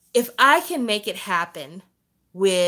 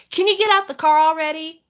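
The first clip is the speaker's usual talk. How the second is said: louder, higher impatient nag; annoyed nagging; 7 semitones higher, 6 dB louder than usual. Retold or imitated. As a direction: hurry her up, fed up. Exasperated with slow daughter.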